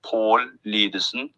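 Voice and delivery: "Paul Lydesen" said slowly the Norwegian way; careful Norwegian name demonstration; Norwegian pronunciation. Shown as a demonstration. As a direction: stretch the O sound in "Paul". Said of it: "have that sound in their name".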